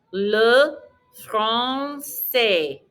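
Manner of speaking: LE FRANÇAIS very slowly, R from the back of the throat; slow model LE FRANÇAIS with a throat R; throaty French R. Shown as a demonstration. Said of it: "R in the back of your throat".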